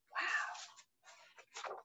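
breathy whispered wow of awe. Real, not acted. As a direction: whisper it in wonder. Reader amazed by the bookshelf.

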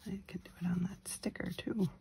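soft unvoiced whisper. Real, not acted. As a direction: breathe the words quietly. Quiet crafting commentary.

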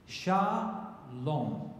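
"Shalom" slowly, leaning on LOM; stress on final syllable. Shown as a demonstration. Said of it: "emphasis is on the final syllable".

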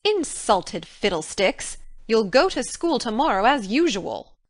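stern guardian's character voice; brisk dismissal, no arguing allowed; dismissive scolding. Acted. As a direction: brush off the complaint firmly. Marilla scolds Anne, dismissing her complaint.

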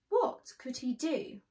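ordinary delivery, nothing marked

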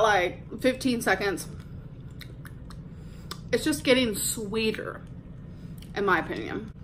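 candy in cheek; slightly muffled mouth; talking while sucking candy. Real, not acted. Seen 0: Candy unwrapped before; cheeks and lips show something held in mouth.

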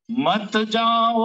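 high, loud, very slowly stretched cry; anguished plea. Acted. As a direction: wail it out slowly, begging. Gopis pleading Krishna. (ऊँची, लंबी करुण पुकार)

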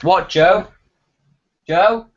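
real interrupted call to Joe. Real, not acted. Called away, shouts to someone off-mic.

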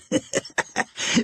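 put-on airy breathy old cackle; old Joe's breathy chuckle; old man's wheezy laugh. A narrator's reading.

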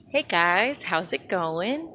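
slow, low, deadpan greeting; sarcastic cloud voice. Retold or imitated. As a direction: drag out a bored hello. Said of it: "He's so cool".

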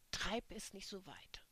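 whispered angry hiss; menacing whisper; hissed warning. Acted. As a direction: hiss the threat through the whisper.

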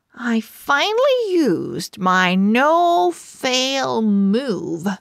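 ordinary delivery

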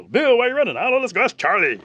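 high, loud hollered exchange; playful, urgent shouted voices; horses calling out. Retold or imitated. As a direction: play runaway horses shouting to each other.

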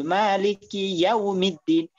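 ইয়াউমিদ্দিন slowly with rounded lips on ইয়াউ; slow demo of মালিকি ইয়াউমিদ্দিন. Shown as a demonstration. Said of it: "ঠোঁট গোল করে ফেলতে হবে".

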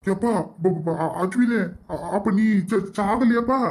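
halting, stuttering question; stunned stammer. Acted. Father asks son if he is not dead, stammering. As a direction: trip over each word in shock.

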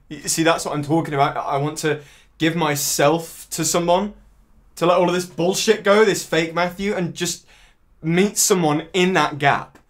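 high, loud, urgent outpouring; impassioned pleading. Acted. Man wanting to meet someone in the gap, impassioned pleading.

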